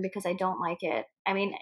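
normal talking voice

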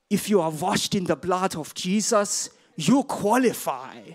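put-on slow, heavy German accent, like an old evangelist; thick German accent. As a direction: imitate Reinhard Bonnke's German accent, slowly. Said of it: "this thick German accent".

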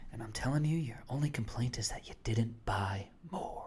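soft, whispered stockbroker pitch; hushed mock sales call; Wolf of Wall Street impression. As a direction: sell it in a conspiratorial whisper. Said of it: "It's like Wolf of Wall Street".

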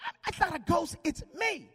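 high loud reply; Jesus answering from the lake; calling back. Acted. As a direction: shout reassurance. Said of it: "And Jesus like".

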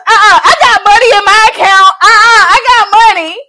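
high, loud, panicked insisting; frantic loud denial; loud flustered protest. Retold or imitated. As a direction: protest loudly that you have money. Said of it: "I said".

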